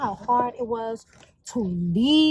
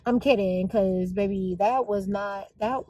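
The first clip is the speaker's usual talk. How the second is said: ordinary talk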